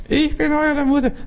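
high falsetto posing as mother; wolf pretending to be mom; wolf faking sweetness. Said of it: "der Wolf verstellt seine Stimme".

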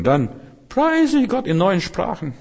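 high, drawn-out exultation; jubilant praise. Retold or imitated. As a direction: break out into high, slow praise. Erzählt jubelndes Gotteslob nach Zweifeln. (jubelndes Gotteslob, hoch und gedehnt)

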